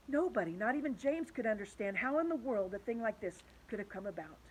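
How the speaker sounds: plain reading voice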